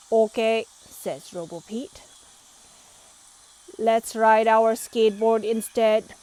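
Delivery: robot voice. A narrator's reading.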